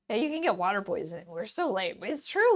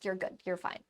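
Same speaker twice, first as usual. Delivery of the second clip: normal talking voice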